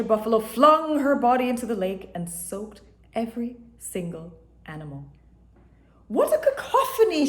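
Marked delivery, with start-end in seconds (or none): 6.1-7.3 high, loud laughing shout. A narrator's reading. Buffalo scolding the noisy animals, high laughing shout. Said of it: "she shouted".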